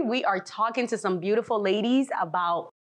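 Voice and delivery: ordinary talk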